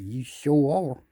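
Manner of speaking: slow drawn-out reply; slow knowing reply; slow answer. Pretend. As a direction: savor each word. Answering the fish slowly.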